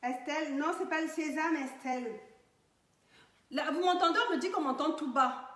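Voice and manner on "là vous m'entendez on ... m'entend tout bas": to someone hard of hearing. Real, not acted. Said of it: "je vais crier, je vais parler fort".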